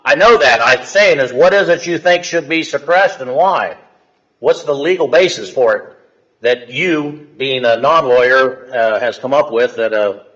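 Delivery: loud, high, furious questioning; wild unhinged ranting. Real, not acted. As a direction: hammer him with angry questions. Judge confronts defendant's legal claims.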